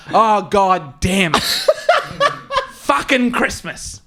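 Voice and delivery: slow exasperated sigh of a line, laughing; Ken, unbothered, grumbling to Dave; weary grumble. Retold or imitated. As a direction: grumble like it's a mere nuisance. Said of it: "says to Dave".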